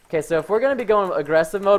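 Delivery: normal talking voice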